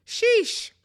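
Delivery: high, loud stunned sheesh. Real, not acted. Stunned by the breakup, a high loud sheesh. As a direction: let the sheesh fly up high. Reacting to Sierra's dumping.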